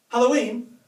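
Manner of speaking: gasped, high and loud "Halloween?" full of hope; excited gasp. Retold or imitated. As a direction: blurt it like an eager kid sure he's right. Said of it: "with genuine excitement".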